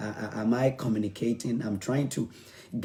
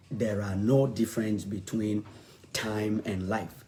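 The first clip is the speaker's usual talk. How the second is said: ordinary talk, nothing marked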